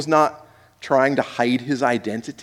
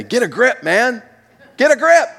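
higher, building to a loud high get a grip; casual pep talk; 8 semitones higher, 4 dB louder than usual. Retold or imitated. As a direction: toss it off like a buddy, louder at the end. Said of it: "we might say".

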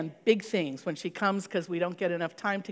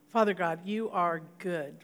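prayer; 3 semitones lower, 5 dB quieter than usual. Acted. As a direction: pray quietly over the congregation. Leads closing prayer.

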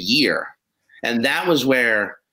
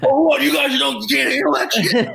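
cocky taunt; 10 semitones higher than usual. Retold or imitated. Young comic mocking the crowd.

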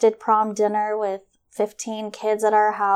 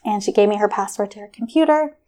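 usual speaking voice

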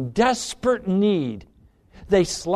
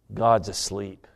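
low hushed refrain; 11 semitones lower, 3 dB quieter than usual. Acted.

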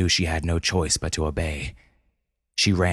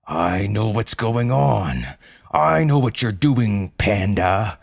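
menacing zookeeper; 4 semitones higher, 3 dB louder, slower than usual. Acted. Zookeeper confronts panda.

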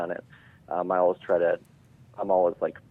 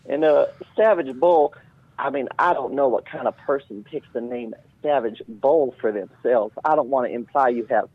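raised, loud, needling villain voice; Cole; 5 semitones higher, 5 dB louder than usual. Pretend. Podcast guest insults a host as his villain, raised mocking voice. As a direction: needle them in a put-on character voice, pitched up. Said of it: "insult us as Cole".

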